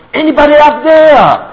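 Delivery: high, loud and slow, calling upward; shout for help. Retold or imitated. Man on the branch calls upward to God, shouting. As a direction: call upward, desperate, begging to be heard. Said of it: "说".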